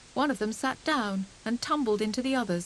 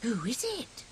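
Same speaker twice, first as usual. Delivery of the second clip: soft, trembling question; trembling whisper; 3 semitones higher, 3 dB quieter than usual. A narrator's reading. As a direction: whisper it in fear. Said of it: "whispered Squirrel in a trembling voice".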